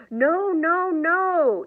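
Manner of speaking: loud, high protesting yell. A narrator's reading. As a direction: shout it like a grown child refusing. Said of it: "yelled Ashley's mom".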